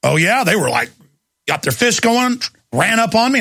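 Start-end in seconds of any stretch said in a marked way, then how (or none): none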